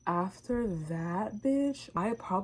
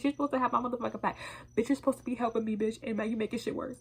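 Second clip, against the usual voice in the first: fast rant; 4 semitones higher, much faster than usual. Real, not acted.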